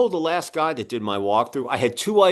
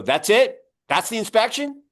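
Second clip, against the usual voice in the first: pitched-up, rising disbelief; retold incredulity; 9 semitones higher, 3 dB louder than usual. Homeowner reacts to the very short inspection, incredulous. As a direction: act out astonished double question, climbing high.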